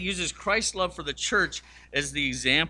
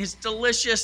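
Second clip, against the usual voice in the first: higher, louder delighted compliment; gushing praise; 8 semitones higher, 4 dB louder than usual. Retold or imitated. Joe to his wife while eating, higher delighted praise. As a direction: rave about the food, higher. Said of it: "as he's eating".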